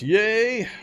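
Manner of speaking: cheering. Real, not acted. Celebrating picture-in-picture worked.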